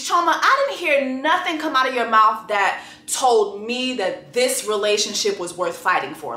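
ordinary delivery

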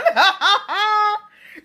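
high, loud real laughing fit; shrieking laughter; uncontrolled laughter. Real, not acted. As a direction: crack up helplessly at your own joke. Cracking up at own impression.

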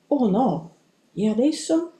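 high, worried exclamation; dismayed. A narrator's reading. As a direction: react in mild panic, voice rising on the question. Left alone with a new problem.

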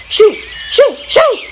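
loud, high bursts of steam; whooshing steam. A narrator's reading. Mike tries to shift the cow, whooshing steam. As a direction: puff each one out sharply, like escaping steam. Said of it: "whooshing steam from his cylinders".

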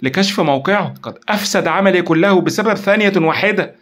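high, loud frustration; distraught exclamation. A narrator's reading. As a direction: lament a near miss with force. Frustrated at losing the call trace.

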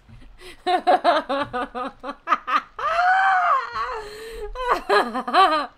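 long, high laughing fit rising to a squeal; hysterical laughter. Real, not acted. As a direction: laugh till it turns into a wail. Overcome by the glitched character.

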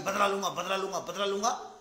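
normal talking voice

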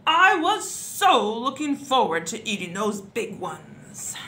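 slow, loud grumbling lament; blustering duke's complaint; shouted complaint. A narrator's reading. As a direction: stretch it out in loud disappointment. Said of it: "shouted the Duke".

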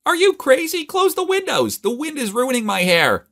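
shrill, high-pitched shouted complaint; frantic high-pitched yelling. A narrator's reading. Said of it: "she shouted".

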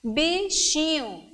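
"Bichinho" slowly, syllables drawn out; slow, careful syllables in "Bichinho". Shown as a demonstration. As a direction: stretch "Bichinho" into slow beats. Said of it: "Três".

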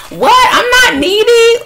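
high, loud protest; hammed-up outraged denial; mock indignation. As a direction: act out an offended, squeaky objection. Imagined viewers protesting being called needy.

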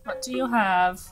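ordinary talk

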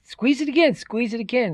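high, insistent wolf's command; urgent urging. Acted. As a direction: urge him on eagerly. Wolf urges boy to crush egg.

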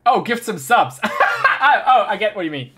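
high, loud, fast realization; excited realization. Real, not acted. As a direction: catch on with a laugh. Realizes, laughing.

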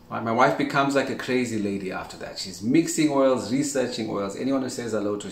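ordinary talk

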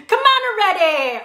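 high-pitched, bad Boston accent; botched Boston accent; attempted Boston accent. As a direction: have a go at a Boston accent, missing it. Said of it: "say this in a Boston accent".

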